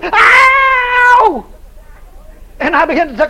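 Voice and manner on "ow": loud, high pained yelp. Retold or imitated. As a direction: let out a sharp cry. Possessed woman cries out.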